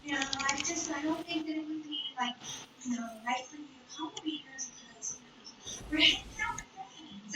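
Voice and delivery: soft, hesitant mumble; timid. Retold or imitated. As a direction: shrink away and barely get it out. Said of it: "a little timid".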